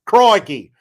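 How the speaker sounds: loud, high Australian exclamation; Australian accent, punched loud; mock Australian accent. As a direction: exclaim it like a caricature Aussie. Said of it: "when he's from Australia".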